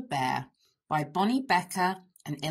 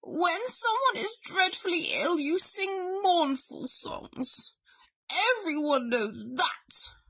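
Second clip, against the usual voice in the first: growling bear; 9 semitones higher than usual. A narrator's reading. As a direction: lecture crossly as a sick bear. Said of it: "growled Bear".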